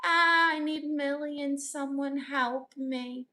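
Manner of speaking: mock-helpless damsel, slow and drawn out; damsel in distress. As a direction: draw out a hammed-up plea for rescue. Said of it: "I am a damsel in distress".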